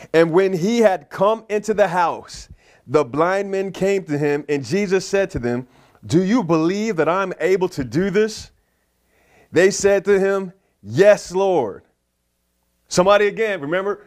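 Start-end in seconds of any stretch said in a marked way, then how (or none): 0.1-11.8 slow, measured preacher's reading; emphatic scripture reading. Acted. Preacher reads the blind men's story to congregation, emphatic reading. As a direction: read scripture out slowly and with weight.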